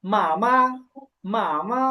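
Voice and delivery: মা, মা with the first syllable toned, the second neutral; first then neutral tone. Shown as a demonstration. Teacher modelling for repetition, first tone then neutral on মা, মা. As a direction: model মা, মা as a Mandarin tone pair. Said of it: "প্রথমে আছে first tone".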